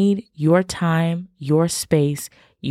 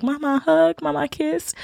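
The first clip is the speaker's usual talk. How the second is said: put-on higher, pleading little kid voice; imitating a small child; 10 semitones higher than usual. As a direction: beg like a toddler at bedtime. Her little boys begging at bedtime.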